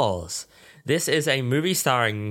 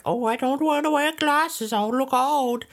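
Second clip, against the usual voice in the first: put-on much higher fussy old lady; old woman voice; 13 semitones higher than usual. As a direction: squeak it like a vain granny. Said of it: "she's like".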